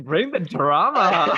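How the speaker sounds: high, loud and drawn out; playful theatrical cheer. Pretend. Host egging on a guest about community cliques, theatrical cheer. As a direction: stretch it into a big mock-dramatic cry.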